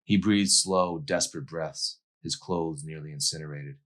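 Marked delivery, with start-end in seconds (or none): none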